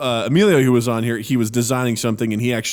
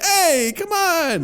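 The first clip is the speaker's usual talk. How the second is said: high mock-wounded objection; imitated pleading complaint; hurt protest; much slower than usual. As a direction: mimic a teased coworker objecting. Coworker protests being teased.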